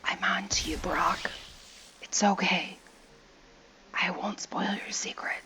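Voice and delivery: playful, hushed secret-keeping; soft mock-confidential murmur; conspiratorial whisper. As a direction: whisper it slyly as if sharing a secret. Conspiratorial aside to pictured look-alike.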